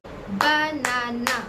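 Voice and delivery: "बनाना" very slowly as ब, ना, ना; three drawn-out, separate syllables in banana. Shown as a demonstration. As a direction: break banana into three clapped beats. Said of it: "तो उसमें मैं भी clap करूंगी".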